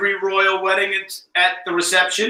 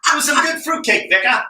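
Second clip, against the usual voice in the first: mock posh British; 8 semitones higher than usual. Mock British tea chat.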